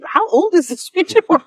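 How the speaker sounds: amazed question. Retold or imitated. Amazed at grandma's Ouija board.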